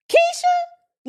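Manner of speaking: name shrieked high and loud. Retold or imitated. Telling the viewers about her friend Keisha, a high loud shriek. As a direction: yelp the name out like a warning.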